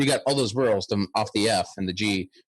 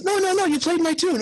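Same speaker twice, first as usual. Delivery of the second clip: delighted judge; 4 dB louder than usual. Retold or imitated. Judge thrilled that the piper played her tune, much higher delighted squeal. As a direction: squeal it with joy like a thrilled fan. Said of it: "She goes".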